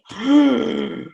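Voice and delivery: sharp high gasp of amazement; impressed audience gasp. Pretend. Teacher showing how the audience reacts, loud gasp. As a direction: gasp like a wowed listener. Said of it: "gonna be like this".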